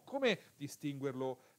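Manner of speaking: usual speaking voice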